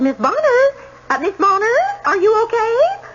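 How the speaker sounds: high, questioning call through a door; worried calling out. Retold or imitated. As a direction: call her name anxiously, pitched well up. Witness re-enacts calling at closed door.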